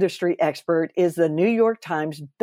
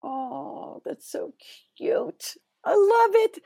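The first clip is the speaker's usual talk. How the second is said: gushing over cuteness; 14 semitones higher, slower than usual. Real, not acted. Host reacting to snuggled baby squirrels, squealing high.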